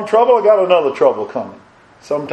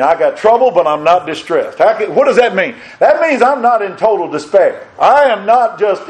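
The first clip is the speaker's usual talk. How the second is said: normal talking voice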